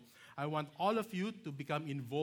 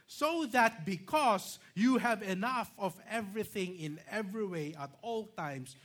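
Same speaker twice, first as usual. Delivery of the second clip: loud, high, forceful preaching voice; emphatic scripture reading; 6 semitones higher, 7 dB louder than usual. Real, not acted. Preacher proclaiming God's promise to Abraham, loud and emphatic.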